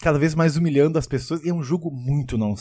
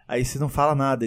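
ordinary delivery

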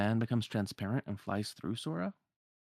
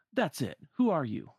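raised, challenging voice; Sora calling out; 5 semitones higher, 5 dB louder than usual. Acted. Sora confronts the hooded man before battle, calling out. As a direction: call out a challenge, higher and louder. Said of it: "And then Sora calls out".

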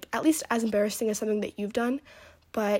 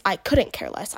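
couldn't hit louder and firm; correct phrase; 5 dB louder than usual. Shown as a demonstration. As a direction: lean on couldn't to correct them. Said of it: "I always tell Leland this".

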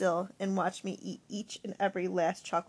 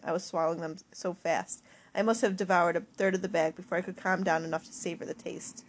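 usual speaking voice

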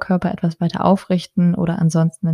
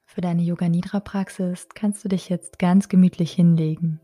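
unhurried, measured phrases, each left to settle; guided meditation. Acted. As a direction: calmly guide a resting mother-to-be into relaxation. Leads a Yoga Nidra meditation.

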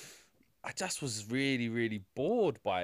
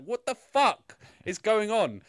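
ordinary delivery

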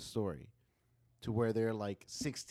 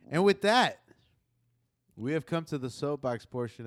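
host announcement; 5 semitones higher, 11 dB louder than usual. Real, not acted. Podcast segment transition.

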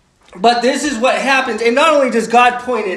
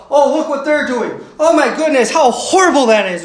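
raised-pitch mock indignation, louder as it builds; indignant; 4 semitones higher, 3 dB louder than usual. Churchgoer judging others' sins, mock indignant. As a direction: act appalled at someone else's doings. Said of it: "and we get indignant".

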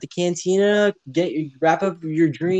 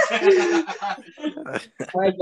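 high pitched laugh over the words; giggling as he tries to wrap up; 12 semitones higher than usual. Real, not acted. To the listeners, laughing while closing.

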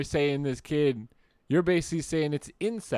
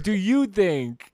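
slow, innocent Do you think; stressed question; 6 semitones higher, 4 dB louder, much slower than usual. Retold or imitated. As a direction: lean on think to plead innocence. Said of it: "I said".

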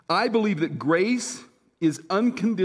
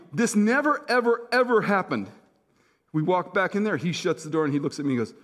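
usual speaking voice

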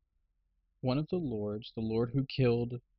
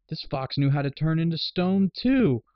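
climbing much higher and louder into a fake whoa; sarcastic fake wonder; mock amazement; 6 semitones higher, 6 dB louder, slower than usual. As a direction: play dumb, wide-eyed and hammed-up. Lord feigns amazement to the queen.

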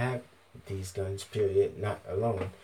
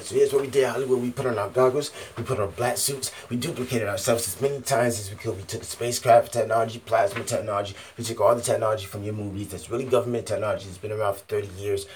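1 hyped-up boast; 3 semitones higher, 7 dB louder, faster than usual. Acted. Building hype tale, rapid.

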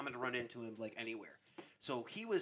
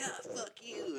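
higher, giving up; resigned fuck you; 8 semitones higher, slower than usual. Retold or imitated. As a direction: toss it off, defeated. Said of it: "And I'm like".

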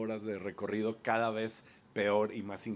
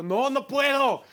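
whining; 13 semitones higher, 7 dB louder, slower than usual. Retold or imitated. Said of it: "yo iba llorando en mi ser".

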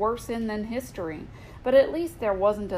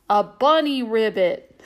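punchline; 8 dB louder than usual. Acted. Punchline of the joke.

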